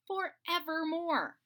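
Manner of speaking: plain reading voice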